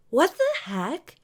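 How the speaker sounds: high, slow startled gasp of words. Acted. Sierra staring at the blacked-out Earth, startled. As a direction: caught off guard, drawn out.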